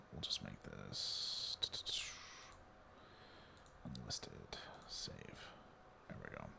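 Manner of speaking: whispered aside while clicking. Real, not acted. Streamer fixing a duplicate stream, whispering to himself. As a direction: mutter to yourself under your breath.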